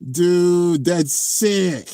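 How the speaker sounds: high, awed enthusiasm; impressed. Real, not acted. Streamer admiring the bay, impressed excitement. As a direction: let out a high-pitched fan's rave.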